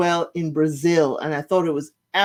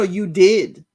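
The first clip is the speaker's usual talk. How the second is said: ordinary talk, nothing marked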